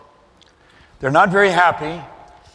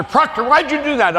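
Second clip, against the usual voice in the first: higher, clipped officer demand; commanding officer; 9 semitones higher than usual. Retold or imitated. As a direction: bark it like a Navy officer grilling a sailor. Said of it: "Every commanding officer".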